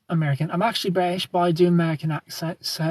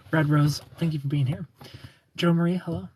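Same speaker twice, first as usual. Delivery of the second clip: ordinary talk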